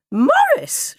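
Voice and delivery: mother's very high, loud shriek; piercing horrified call. A narrator's reading. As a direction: shriek your child's name in alarm. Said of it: "shrieked his mum".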